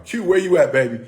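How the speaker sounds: loud call to someone off mic. Real, not acted. Calling co-host Q to join.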